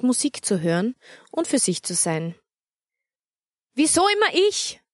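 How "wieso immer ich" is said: put-on high, indignant child's protest; angry outcry. Anita protests to her mother, high and indignant. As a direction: yell it back like a put-upon girl. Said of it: "rief sie erbost zurück".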